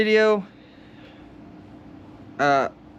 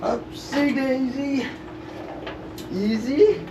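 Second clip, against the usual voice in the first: slow sing-song effort; 8 semitones higher, much slower than usual. Real, not acted.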